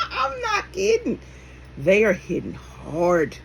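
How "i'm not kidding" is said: laughing. Real, not acted. Laughing after her own joke.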